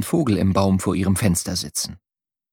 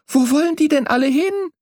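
a lightly higher, curious call; young bird calling out. A narrator's reading. Young bird calls after the swallows, curious. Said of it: "rief ich".

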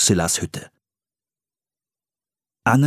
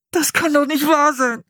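high, anguished groan; despairing boy's wail.

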